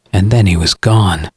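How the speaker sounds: ordinary delivery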